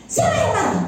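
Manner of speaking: high-pitched indignant retort, imitated; mock the husband protesting; husband's heated denial. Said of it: "Bapak marah-marahin saya". (meniru suami membantah dengan nada tinggi)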